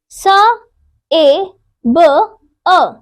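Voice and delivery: सेब spoken as separate sounds स, ए, ब, अ; sounds of सेब split out. Shown as a demonstration. Teacher to children, spelling out सेब sound by sound, slowly. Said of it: "सेब बोलते समय".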